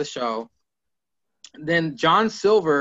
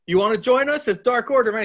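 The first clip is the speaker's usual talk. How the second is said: eager recruiting; 7 semitones higher, 4 dB louder, faster than usual. Retold or imitated. Retold eager recruiting pitch.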